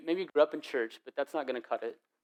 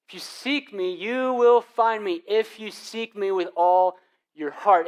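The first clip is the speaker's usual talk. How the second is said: louder, higher, slow preached promise; preaching emphasis; 6 semitones higher, 7 dB louder, slower than usual. Shown as a demonstration. Pastor repeating God's promise to the church, loud and slow. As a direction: proclaim If you seek me slowly and boldly. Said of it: "repeats the promise".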